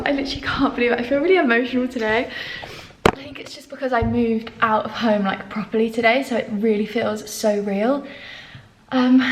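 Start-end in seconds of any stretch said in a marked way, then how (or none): none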